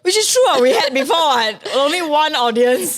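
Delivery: high and loud, laughing along; bright amused agreement, slowed; excited. Real, not acted.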